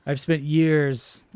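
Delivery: slow, stretched "years"; drawn out. Real, not acted. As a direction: say it slowly, dragging out "years". First take of repeated line.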